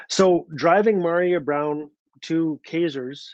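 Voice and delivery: ordinary delivery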